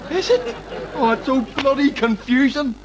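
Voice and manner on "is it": surprised squeak. Acted. Surprise at learning.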